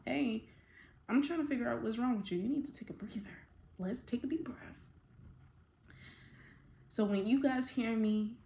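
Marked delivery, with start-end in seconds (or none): 0.0-4.8 soft, soothing mom voice; mother calming her upset son, softly; calming a child. Retold or imitated. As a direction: gently talk a hyper kid down. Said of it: "what I do with my son when he's a little bit hyper emotional".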